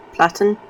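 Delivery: "Platon" as PLAT-un; trying a pronunciation. Shown as a demonstration. Unsure of a character's name, trying "Platon" as PLAT-un. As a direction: say "Platon" with a short "a", questioning. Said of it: "How do you pronounce that?".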